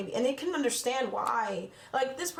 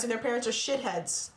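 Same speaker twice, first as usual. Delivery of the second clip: normal talking voice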